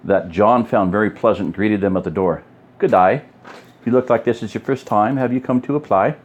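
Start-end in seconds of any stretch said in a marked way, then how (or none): 2.8-6.2 attempted Australian accent, lifted in pitch. A narrator's reading. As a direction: read her greeting in a light Australian accent. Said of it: "with an Australian accent".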